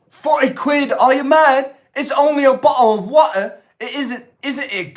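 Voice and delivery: high, loud hammed-up cockney; over-the-top British shopkeeper; loud exaggerated British accent; botched British accent. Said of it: "just botch that up".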